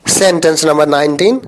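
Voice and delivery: normal talking voice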